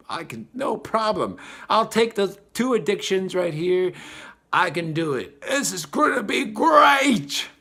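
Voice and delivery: high, loud boast peaking in a cry-shout; hammed-up overconfident cheer; mock bravado. Soul boasting before incarnation.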